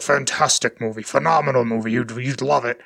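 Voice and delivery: low-pitched impression of a history teacher; deep-voiced teacher. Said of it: "he had this very deep voice".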